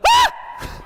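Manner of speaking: very high, loud shriek of horror; mock scream. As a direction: yelp at the ruined shoe. Seeing stain smear.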